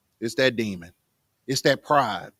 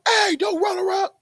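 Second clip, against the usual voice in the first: high-pitched yell across the room; scolding parent's scream; mock yell. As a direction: yell it out sharply at running kids. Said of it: "I can't just yell and scream".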